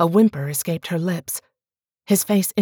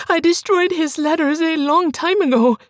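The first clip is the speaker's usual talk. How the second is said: much higher and louder, begging; desperate pleading wife; 11 semitones higher, 4 dB louder than usual. Acted. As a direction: beg him for belief. Bianca insists.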